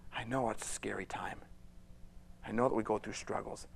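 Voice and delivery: whispered comfort; hushed reassuring whisper. Real, not acted. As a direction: soothe the frightened listener softly.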